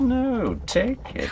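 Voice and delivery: high, sneering command; taunting insistence. Acted. Gunman forcing the gun on Sophie, high sneering insistence. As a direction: push it on her with a sneer.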